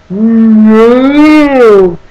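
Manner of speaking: a sudden loud flat refusal; loud emphatic no. Real, not acted. Reviewer rejecting the film's choice, loud emphatic no. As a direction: bark out a single no.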